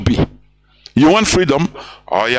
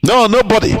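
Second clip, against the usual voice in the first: higher, firm refusal in a mild imitation; quoting the leader; 5 semitones higher than usual. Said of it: "Mazi Nnamdi Kanu said".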